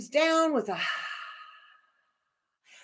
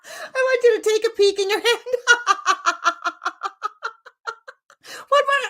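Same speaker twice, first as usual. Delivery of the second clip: high, laughing through the words; real giggling; 11 semitones higher, 4 dB louder than usual. Real, not acted. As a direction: speak while cracking up. Laughing through the peek exercise.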